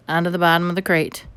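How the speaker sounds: plain reading voice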